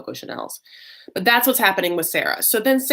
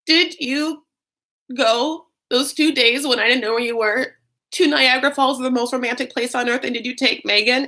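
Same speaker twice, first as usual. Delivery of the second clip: higher, halting mock interrogation; very upset; 6 semitones higher than usual. Wife questioning Michael about Niagara Falls, mock upset. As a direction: press each piece of the question, imitating her upset. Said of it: "like, very upset".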